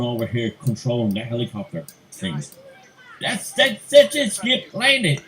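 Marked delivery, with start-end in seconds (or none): 3.2-5.2 a much higher, louder urgent cry to the kangaroo; urging Skip to land, pitched way up; excited. Acted. Voicing the man in Skippy.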